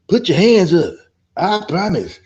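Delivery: high, loud call to the crowd; mock rally call. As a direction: parody a protest chant leader.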